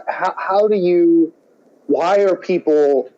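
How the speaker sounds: ordinary delivery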